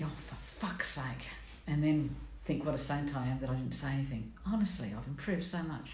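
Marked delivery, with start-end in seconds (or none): 0.0-1.3 muttered under breath. Retold or imitated. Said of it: "I take a deep breath and think".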